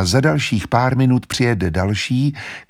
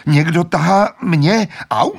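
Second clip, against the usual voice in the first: high, louder pained cry; frightened protest; 8 semitones higher, 4 dB louder than usual. Acted. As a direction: plead for it to stop. Susan being pulled, pained. (Zuzana bolestně a vyděšeně křičí)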